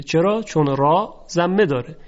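ordinary talk, nothing marked

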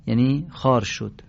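normal talking voice

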